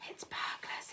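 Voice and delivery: urgent breathy whisper; hissed whisper. A narrator's reading. The mother warning the family in an urgent whisper. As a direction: hiss it so the burglars can't hear. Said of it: "hissed the mother".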